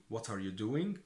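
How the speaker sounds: ordinary talk, nothing marked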